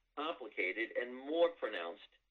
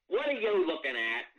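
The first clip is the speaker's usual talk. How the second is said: confrontational challenge; 7 semitones higher, 7 dB louder than usual. Retold or imitated. Mary challenging a passerby.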